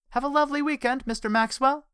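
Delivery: high, bright-voiced cheerful receptionist; chipper receptionist. A narrator's reading. Receptionist wishing Richard a lovely weekend, chipper and high. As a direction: chirp it out sweetly to a client. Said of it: "said the chipper receptionist".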